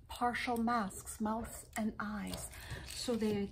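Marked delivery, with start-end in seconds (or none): none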